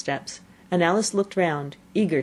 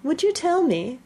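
timid; 11 semitones higher than usual. A narrator's reading. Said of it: "said Alice a little timidly".

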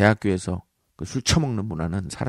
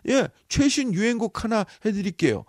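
eager singer; 10 semitones higher than usual. Pretend. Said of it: "언제 때 빠빠빠야".

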